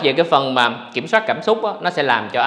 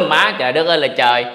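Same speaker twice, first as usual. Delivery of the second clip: normal talking voice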